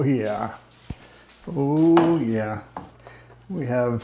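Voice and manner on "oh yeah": usual speaking voice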